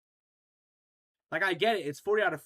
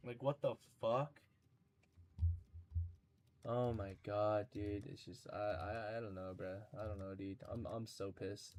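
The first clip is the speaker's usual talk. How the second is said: much softer, drained and low, trailing off; defeated mutter; 7 semitones lower, 10 dB quieter than usual. Real, not acted. Streamer defeated by the impossible challenge, drained and soft. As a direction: sink into resigned frustration. Deflated after seeing the requirements.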